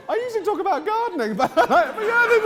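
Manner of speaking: high, loud laughing through words; embarrassed laughing. Real, not acted. Embarrassed laugh after sharing.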